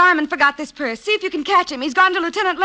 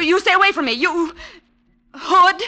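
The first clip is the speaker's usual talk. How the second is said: frightened; 4 semitones higher, 3 dB louder than usual. Acted. Girlfriend frightened by the thug.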